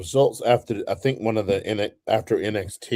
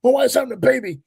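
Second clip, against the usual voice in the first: high, slightly louder mock panic; panicked quote; 13 semitones higher, 5 dB louder, faster than usual. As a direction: squeal it like a frantic bystander. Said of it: "they're like".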